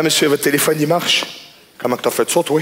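normal talking voice

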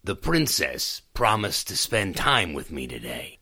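plain reading voice

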